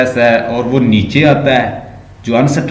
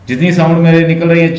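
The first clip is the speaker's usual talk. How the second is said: ordinary delivery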